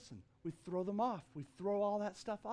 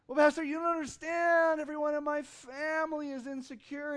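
higher, whiny complaint to the pastor; complaining congregant; 7 semitones higher, 5 dB louder than usual. Pretend. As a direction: play a doubting churchgoer whining.

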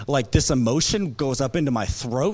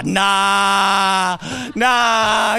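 loud, higher shout of denial; disbelieving shout; 3 semitones higher, 5 dB louder than usual. Retold or imitated. Pastor retelling hearing wife is pregnant, loud denial. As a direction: refuse to believe it out loud. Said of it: "I said".

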